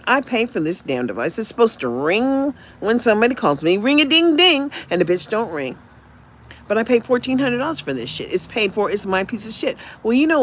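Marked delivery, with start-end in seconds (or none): 3.8-4.7 ringing phone imitation.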